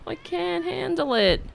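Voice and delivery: normal talking voice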